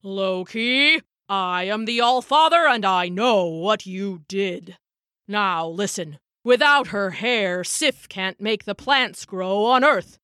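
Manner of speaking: grave, put-on god voice; stern All-Father. Odin warning Loki of starving humans, grave stern voice. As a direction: lay down the law with solemn weight.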